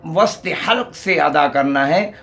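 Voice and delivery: ordinary talk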